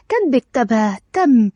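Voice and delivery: ordinary delivery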